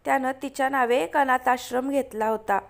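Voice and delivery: ordinary delivery, nothing marked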